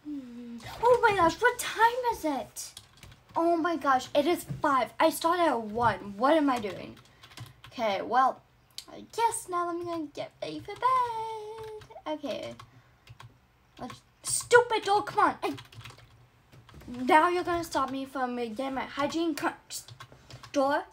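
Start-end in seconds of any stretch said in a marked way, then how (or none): 0.8-7.0 loud startled panic; panicked. Acted. Realizes time passed. 13.8-20.8 loud irritated yelling at door; annoyed. Acted. Door won't open.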